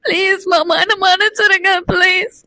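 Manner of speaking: sobbing plea for forgiveness; crying, desperate apology; tearful begging. Acted. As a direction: beg please through tears. Wife sobs, begging forgiveness.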